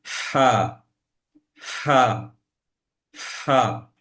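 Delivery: হা released with air from mid-throat; breath-pushed হা. Shown as a demonstration. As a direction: let the breath out firmly on হা. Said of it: "শ্বাসটা বের করে দিতে হবে". (শ্বাস বের করে শক্ত হা)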